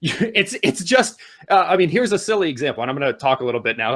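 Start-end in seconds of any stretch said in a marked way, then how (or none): none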